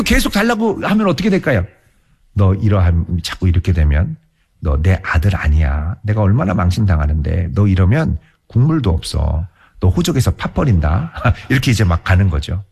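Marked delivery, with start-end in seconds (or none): 2.3-11.1 lower, mock-stern father; threatening father. Father threatening to disown his demanding son, low mock sternness. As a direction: bluster the threat, voiced lower.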